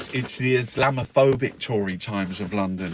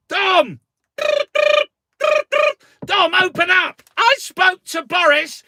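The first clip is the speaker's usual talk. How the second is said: hammed-up banging on Dom's door; frantic high shouting, playing a desperate minister; mock frantic caller; 13 semitones higher than usual. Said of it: "So Raab is like".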